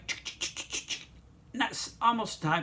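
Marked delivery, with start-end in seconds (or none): 0.1-1.1 unvoiced tick tick tick like a coaster climbing; soft roller coaster ticking; whispered ticking. Shown as a demonstration. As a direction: whisper the clicks of the chain lift. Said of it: "second hill".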